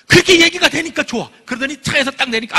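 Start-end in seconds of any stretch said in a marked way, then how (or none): none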